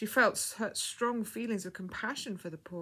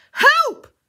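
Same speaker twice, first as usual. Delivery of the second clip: very high, loud cry for help; scream; 20 dB louder than usual. A narrator's reading. Woman calling nurses to the bleeding girl, screaming. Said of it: "screamed Missus Beloved of the King".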